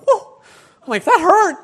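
squeaky high laughing surprise; high surprised yelp through laughter; stunned and laughing. Retold or imitated. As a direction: laugh out the shock of the slap. Slapped by guard, retold shock.

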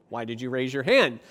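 low mock scolding, punched on the last words; acted self-reproach, landing loud on "fool"; scolding inner voice. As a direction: berate like a nagging voice in the head. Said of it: "you'll be hearing that voice".

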